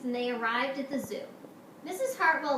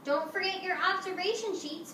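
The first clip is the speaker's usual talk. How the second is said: higher-pitched teacher's call; lightly projected, lilting reminder; 7 semitones higher than usual. Acted. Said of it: "Missus Hartwell called".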